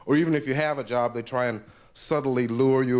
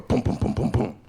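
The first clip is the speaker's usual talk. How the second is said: normal talking voice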